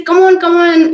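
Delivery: mock impatient prodding of a calm husband; impatient urging. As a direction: hurry him along, fed up with waiting. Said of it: "And I used to say".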